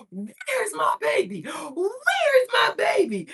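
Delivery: high, slow, loud gushing call; excited baby talk. Retold or imitated. Owner bursting into the groomer's, calling loudly high for her dog. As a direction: gasp and call for the dog, thrilled. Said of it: "I was like".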